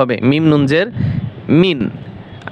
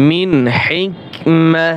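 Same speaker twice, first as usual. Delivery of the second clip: slow recitation; much slower than usual. Shown as a demonstration. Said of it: "মিম জবর মা".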